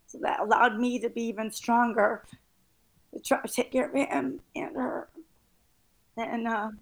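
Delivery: crying while pushing on speaking. Real, not acted. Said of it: "I'm sorry, I'm crying".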